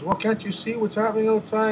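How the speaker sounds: incredulous high. Retold or imitated. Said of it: "He says".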